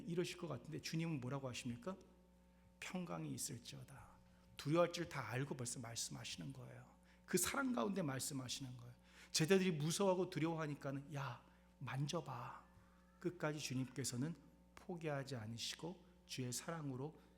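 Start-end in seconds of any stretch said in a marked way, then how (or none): 2.8-3.9 gentle blessing. Retold or imitated. Pastor voices Jesus' blessing. 11.2-12.6 soft, very slow invitation; tender Jesus. Retold or imitated. Jesus to frightened disciples, soft and slow.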